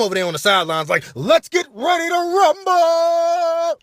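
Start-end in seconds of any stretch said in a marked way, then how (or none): 1.1-3.8 high, loud, stretched-out call; long shouted fight intro; ring announcer. Acted. Ring announcer catchphrase from sidelines.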